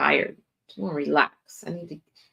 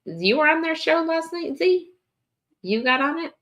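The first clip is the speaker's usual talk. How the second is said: surprised; 11 semitones higher than usual. Real, not acted. Surprised at chat friend.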